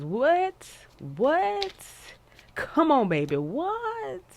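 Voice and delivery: high, loud disbelieving "What?"; shocked squeal. Real, not acted. Reader shocked at the card she pulled, high squeal.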